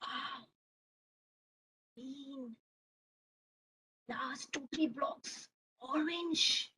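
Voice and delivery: very soft, to himself; barely voiced, absorbed in delicate play; child murmuring over careful building; hushed concentration. Boy concentrates on last blocks.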